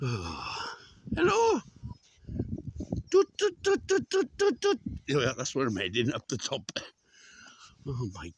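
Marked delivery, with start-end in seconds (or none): 1.2-1.6 very high, loud hello; calling out. Real, not acted. Walker hails animals, very high call. 3.1-4.8 high, rhythmic toot-toot call; high tooting noise. Pretend. Walker toots at the animals, high tooting.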